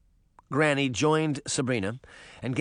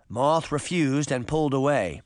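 usual speaking voice

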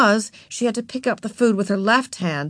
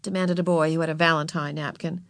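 ordinary delivery, nothing marked

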